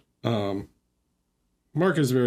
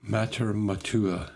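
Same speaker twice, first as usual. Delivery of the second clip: mock French accent. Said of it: "I'm super good at French accent".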